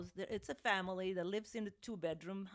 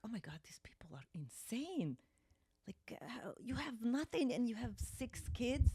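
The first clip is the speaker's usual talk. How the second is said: hushed disbelieving whisper; 3 semitones higher, 4 dB quieter than usual. Retold or imitated. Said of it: "I was like".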